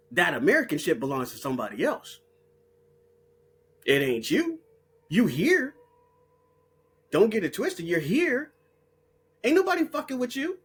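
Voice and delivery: ordinary talk, nothing marked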